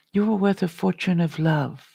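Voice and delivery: ordinary delivery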